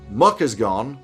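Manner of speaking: normal talking voice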